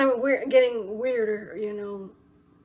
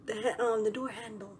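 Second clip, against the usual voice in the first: hushed, trailing down softer; imitated whispering; 7 dB quieter than usual. Man behind her asking for the door handle, hushed. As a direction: drop to an undertone, imitating him.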